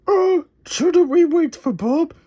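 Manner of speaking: high, hesitant nervous question. Acted. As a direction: ask timidly, up high. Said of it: "he asked nervously".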